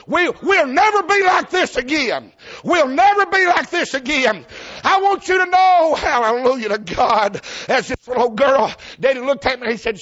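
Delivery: high, loud, swelling sermon delivery; full force preaching. Acted. Preacher stirring the church with his testimony, high and loud. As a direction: lift the congregation with it.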